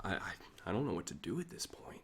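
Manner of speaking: breathy, hushed whisper. Real, not acted. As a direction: whisper it, embarrassed. Embarrassed on his own livestream.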